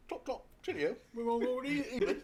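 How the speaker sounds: high, hammed-up British chirp; bad English accent. Joking about neighbours' England trip, hammy English accent. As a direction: put on a silly English accent. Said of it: "We're terrible at your accent".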